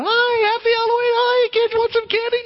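very high, bright put-on candy offer; a costumed man sweetly luring kids with candy; Bob's sing-song greeting. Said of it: "he was walking around going".

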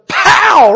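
high, very loud impact sound; explosive pow. Retold or imitated. Dad's throw hitting his head, explosive shout. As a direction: burst it out like a sudden blow.

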